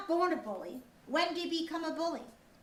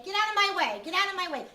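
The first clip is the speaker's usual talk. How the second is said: bully shoving; 4 semitones higher, 5 dB louder, faster than usual. Shown as a demonstration. Acting out a bully pushing.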